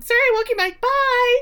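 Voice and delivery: very high, singsong goodbye; mock cheery sign-off. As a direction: sing out a quick phone brush-off. Retold brush-off ending phone call.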